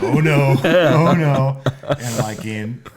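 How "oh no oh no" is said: loud, high alarmed cry; loud panicked gasp of alarm. Retold or imitated. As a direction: shout it as the fight starts. Kid goes over the fence.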